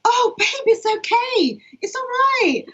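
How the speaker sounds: high cooing "oh babe"; panel's sympathetic high coo; panel comforting her. Retold or imitated. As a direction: coo it like soothing a child. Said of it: "and everyone went".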